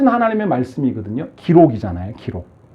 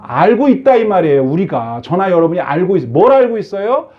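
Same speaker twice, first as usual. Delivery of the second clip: usual speaking voice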